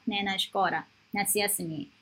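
ordinary talk

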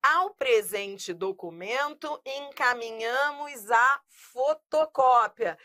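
"encaminhamos a fotocópia" read slowly, stressing "a"; slow example read. Shown as a demonstration. Teacher reading the exercise sentence aloud, slow and clear. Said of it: "Vem comigo".

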